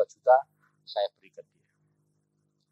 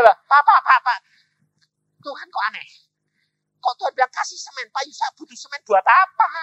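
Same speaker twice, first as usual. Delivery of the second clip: high-pitched puzzled protest; bewildered man, voice pitched up high; acted bafflement; 10 semitones higher, 9 dB louder than usual. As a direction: question it in mock disbelief. Baffled man questioning God's message.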